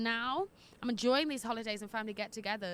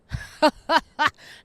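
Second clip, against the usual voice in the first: loud burst of cackling; loud cackle; 3 semitones higher, 12 dB louder than usual. Real, not acted. Host cackling about being single in her early twenties. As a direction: cackle at the memory. Laughs recalling wild twenties.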